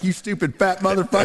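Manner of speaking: taunting truckers over the CB, cracking up; mock CB insult. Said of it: "I'm like".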